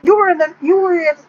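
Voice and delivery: freaking out. Acted. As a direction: squeal it like stunned Texans losing it. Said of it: "they're freaking out and shit".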